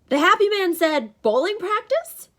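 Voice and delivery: high, loud, naive question; puzzled simple soul. A narrator's reading. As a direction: ask it in innocent confusion. Tyson puzzles over announcement.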